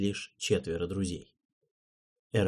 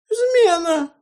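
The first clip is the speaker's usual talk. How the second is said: a very high falsetto squeak; frightened official squealing treason in falsetto; 8 dB louder, slower than usual. A narrator's reading. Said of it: "пискнул Наджел фальцетом".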